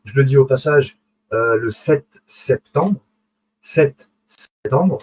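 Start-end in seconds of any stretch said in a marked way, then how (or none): 1.6-3.0 sept septembre, slow and drawn out; teacher lingering on the date for viewers; slow emphasis. Shown as a demonstration. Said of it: "Pourquoi je dis ça?". 3.6-5.0 Sept septembre stretched very slowly; repeating the date slowly for emphasis. Shown as a demonstration. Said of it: "Pourquoi je dis ça?".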